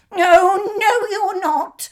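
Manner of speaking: high, loud goat cry; high loud goat defiance; defiant goat. A narrator's reading. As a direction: fire it back high. Goat defies troll.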